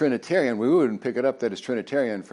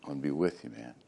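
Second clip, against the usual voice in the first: much softer, low and tender promise; reassuring God; 6 semitones lower, 10 dB quieter, faster than usual. Retold or imitated. God to Moses, softly promising to walk with him. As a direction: reassure him gently, eye to eye. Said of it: "I'm gonna look you in the eye and go".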